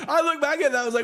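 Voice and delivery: high-pitched, laughing through the words; laughing while talking. Real, not acted.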